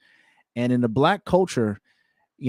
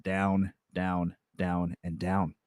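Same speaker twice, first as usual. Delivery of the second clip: sinking quieter and lower; fading away; 5 semitones lower, 8 dB quieter, slower than usual. Acted. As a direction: let each down drop lower.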